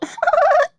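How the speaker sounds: very high-pitched imitation laugh; Aubrey's little laugh. Showing how a TikToker laughs, imitated high little laugh. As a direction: let out a loud, high mimicked giggle. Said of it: "He's known for his little".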